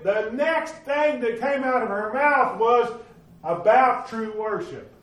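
high, loud, fervent pulpit preaching; preaching at full force. Acted. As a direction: drive it home to the congregation.

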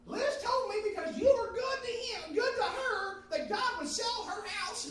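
loud, very high imitated woman; high-pitched voice. Preacher imitating coworker wanting the same favor, high-pitched voice. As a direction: screech it in a put-on high voice. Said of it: "had a real high-pitched voice".

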